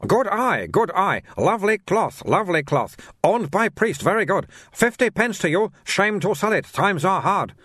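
high-pitched, sing-song sales patter in broken English; haggling like an eager shopkeeper; pushy shopkeeper Soon. Acted. Narrator voices the shopkeeper Soon.

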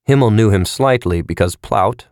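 ordinary delivery

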